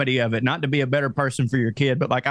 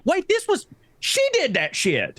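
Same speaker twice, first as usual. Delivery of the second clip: realization; 14 semitones higher than usual. Retold or imitated. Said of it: "I go to bed and I'm like".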